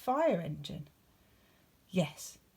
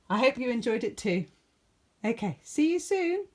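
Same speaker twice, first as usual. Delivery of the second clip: higher, louder bright sign-off; cheery goodbye; 7 semitones higher, 6 dB louder than usual. Real, not acted. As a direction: wave them off happily. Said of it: "signing off to child viewers".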